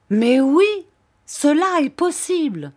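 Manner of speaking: high, loud exclamation; proclaiming loudly. A narrator's reading. Sir Hassel insists the journey can be done, proclaiming. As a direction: cry it out with full conviction. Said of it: "clama sir Hassel".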